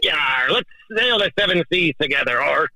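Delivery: put-on high, hammy pirate accent, arr matey style; a pirate captain voice hamming it up on a voicemail. Caller woos Shelly on voicemail in his pirate accent, hammed up high. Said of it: "I know you've never heard this voice before".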